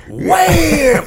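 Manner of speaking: shouted high impact word; punch sound. Retold or imitated. Telling how the sergeant major's punch landed, shouted loud and high. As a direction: yell it out like the punch landing.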